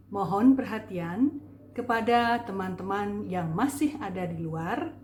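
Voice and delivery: ordinary talk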